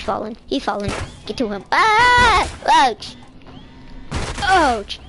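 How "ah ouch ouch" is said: high scream, then loud yelps; mock pain shouts. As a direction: cry out at each impact, hammed-up. Voicing ragdoll hitting things.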